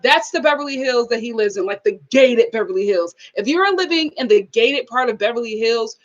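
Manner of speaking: usual speaking voice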